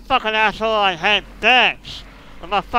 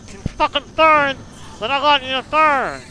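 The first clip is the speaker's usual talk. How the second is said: high, loud, frustrated plea; exasperated yelling; 7 semitones higher, 4 dB louder than usual. Real, not acted. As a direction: shout your frustration at the enemy. Enemy will not burn.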